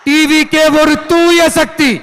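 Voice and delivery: high, slow rally slogan; slogan proclamation. Real, not acted. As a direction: proclaim it slowly to the crowd. (உரத்த, நிதானமான அரசியல் முழக்கம்)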